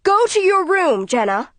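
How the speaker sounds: mother commanding daughter upstairs; mother yells out. Acted. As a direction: order her off to her room. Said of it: "Mother yells out".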